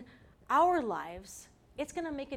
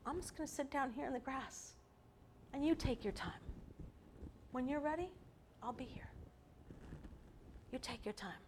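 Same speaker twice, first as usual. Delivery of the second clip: softer, unhurried, reassuring; gentle patient father; 10 dB quieter than usual. Retold or imitated. Heavenly Father reassuring a scared child at the top, soft and patient. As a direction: tell a scared child there's no rush. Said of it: "your Heavenly Father says".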